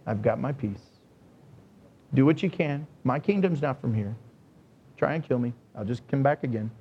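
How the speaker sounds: a deep hush of quiet confidence; calm unshaken voice. Pretend. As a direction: speak as serene power that fears nothing. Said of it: "It says".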